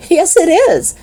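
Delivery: high teasing laugh; laughing girl voice. A narrator's reading. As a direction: tease through a laugh.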